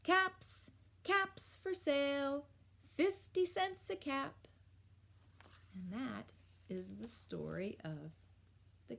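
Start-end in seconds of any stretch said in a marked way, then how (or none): 0.0-4.3 high-pitched peddler's cry; singsong hawking call; street vendor's call. A narrator's reading. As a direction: call out your wares to the town. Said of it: "he walked back to town calling".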